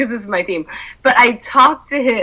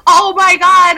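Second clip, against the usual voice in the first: shocked; 10 semitones higher, 5 dB louder, slower than usual. Retold or imitated. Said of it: "I was like".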